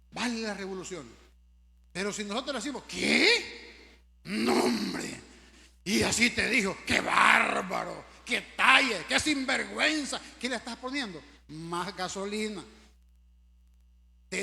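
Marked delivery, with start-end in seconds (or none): 2.9-10.2 loud, shocked gossip egging on a quarrel; mock outrage at the news; outraged gossip. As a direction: gasp and fan the fire of the rumor.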